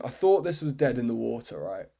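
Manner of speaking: ordinary talk, nothing marked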